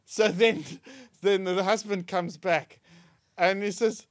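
normal talking voice

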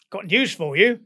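spring the surprise like a cheeky Cockney; teasing Cockney announcement. Retold or imitated. Pilot springs news on the mechanic, teasing Cockney. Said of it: "he was a Cockney".

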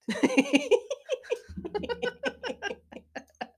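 long, high-pitched devilish giggle; devilish laugh. Real, not acted. Co-host leaving nothing for the other, devilish laugh. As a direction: cackle high and drawn out, devilishly. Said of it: "Devilish laugh".

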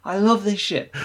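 high, gushing delight; excited gushing. Retold or imitated. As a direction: gush like hearing your favourite song. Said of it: "you're like".

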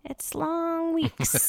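long weeks, said slow and high; slow drawn-out sigh. Acted. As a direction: drag the words out tiredly. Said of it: "drawn out for effect".

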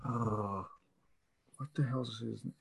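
ordinary talk

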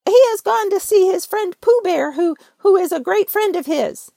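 high, loud fussy Rabbit voice; fibbing Rabbit. Acted. Rabbit pretends Pooh's friend isn't home, high-voiced. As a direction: keep up the fib in a high squeaky voice.

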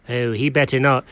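usual speaking voice

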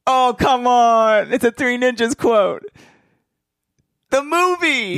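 high, slow exasperated plea; drawn-out exasperation. Real, not acted. Friend misses movie quote.

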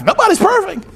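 high, singsong excuse; acted excuse. Husband excusing his mistakes to his wife, high and playful. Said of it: "I say it all the time to my wife".

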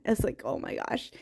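whispered awe. Real, not acted. Overwhelmed by the movie's scene.

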